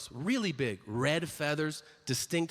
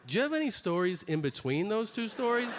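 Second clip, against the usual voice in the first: put-on exasperated teen voice; 7 semitones higher than usual. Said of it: "And I go".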